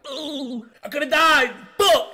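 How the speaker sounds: high, loud wail of a hurting patient; imitated yelling. Hospital roommate with a shattered leg, imitated yelling in pain. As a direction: mimic an injured man hollering. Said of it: "he's yelling".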